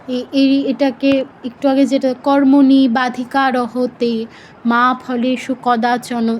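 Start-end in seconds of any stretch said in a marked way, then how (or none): 2.2-6.4 slow, choppy recitation, breaking "কর্মণি বাধিকা রহতে মা ফলেষু কদাচন" in the wrong places; pausing anywhere. Shown as a demonstration. Teacher shows how random stops spoil the verse, pausing anywhere. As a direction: chop the verse into halting little pieces. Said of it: "যেখানে সেখানে যদি আমরা থামি".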